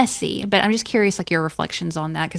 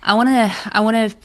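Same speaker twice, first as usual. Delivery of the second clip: louder and higher, yearning outburst; excited longing; 4 semitones higher, 6 dB louder than usual. Retold or imitated. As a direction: cry out wanting to feel alive. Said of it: "And I'm just like".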